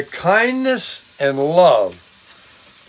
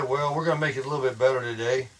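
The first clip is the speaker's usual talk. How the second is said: ordinary delivery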